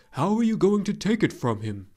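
the dragon presses his friend for a plan; luck dragon asking. Acted. Falkor asks Atreyu.

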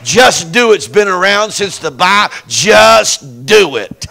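slow, loud Just do it; preached punchline. Acted. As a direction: hammer it home.

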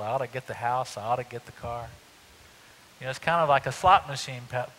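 normal talking voice

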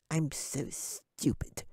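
high, slow, soft squeak of a tiny creature; squeaky little voice. A narrator's reading. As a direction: squeak it out quietly, barely human. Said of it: "a little squeaky voice that barely sounded human".

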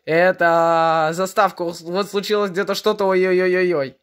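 normal talking voice

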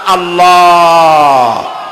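আল্লাহ with a long, rounded, swelling last vowel; rounded long pronunciation. Shown as a demonstration. Preacher demonstrating God's name, long rounded pronunciation. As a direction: draw out the end of আল্লাহ, round-mouthed. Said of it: "শুদ্ধ হচ্ছে আল্লাহ".

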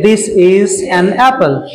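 very slow, each word drawn out; slow reading demo. Shown as a demonstration. Teacher reads a sample sentence slowly to students. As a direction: read it out slowly for the class. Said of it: "ऐसे तो पढ़ेंगे".

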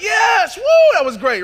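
high, loud whoop of roller-coaster joy; excited cheer. Retold or imitated. Rider after a roller coaster, shouting excited joy. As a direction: shout it like you just got off the ride.

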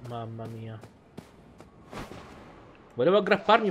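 usual speaking voice

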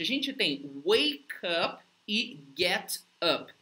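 normal talking voice

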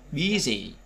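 BU-sy, stressed on BU; first-syllable stress. Shown as a demonstration. Teacher giving an adjective example, stress on BU in Busy. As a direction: lean on BU in Busy, light -sy. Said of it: "âm tiết thứ nhất".

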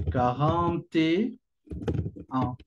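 "quarante et un" muy lento, con pausas entre palabras; slow demo pronunciation. Shown as a demonstration. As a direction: alarga cada palabra de quarante et un. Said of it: "C'est ça la prononciation". (despacio, como modelo de pronunciación)

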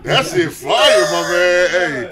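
slow, loud hype shout. Real, not acted. Shouting praise of album.

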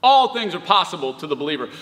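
normal talking voice

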